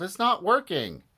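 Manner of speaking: put-on high-pitched, fretful boy; dismayed cry. A narrator's reading. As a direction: cry it out in alarm. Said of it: "cried Harold".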